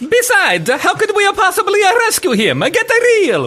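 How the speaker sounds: high cartoon Super Mario Italian accent. Radio host acts a movie scene for listeners in a high cartoon Italian accent. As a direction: bounce it like a cartoon Italian plumber. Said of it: "a very cartoon Italian accent".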